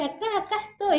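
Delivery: high-pitched mock child's call; playful, high little-kid voice; excited little girl. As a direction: imitate an excited toddler revealing her hiding place. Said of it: "pero después se emociona y dice".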